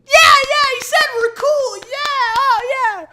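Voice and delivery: very high, slow dopey falsetto; dumb crowd member. Retold or imitated. Mocking the crowd cheering Memphis, dopey falsetto. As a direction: squeak like a dim audience member.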